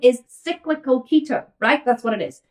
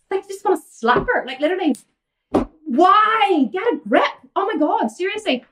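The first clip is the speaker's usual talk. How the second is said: pitched way up in disbelief, half laughing at it; can't believe anyone would do this; exasperated; 7 semitones higher than usual. Real, not acted. Ranting to viewers about a client, exasperated disbelief.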